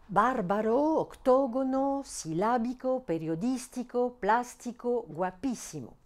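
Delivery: Bárbaro, octógono, silábico stressed on BÁR, TÓ, LÁ; drilled list, Bárbaro to guapísimo, each hit on the accented vowel; stress on third-last syllable. Shown as a demonstration. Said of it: "la syllabe antépénultième".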